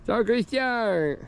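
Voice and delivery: high, drawn-out call to a dog. Real, not acted. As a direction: sing out a long greeting. Greeting a dog from afar.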